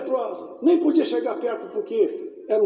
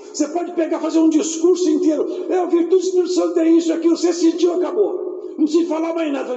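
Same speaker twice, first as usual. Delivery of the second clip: full-force pulpit testimony; fervent preaching; 4 semitones higher than usual. Acted. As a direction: preach it with fire.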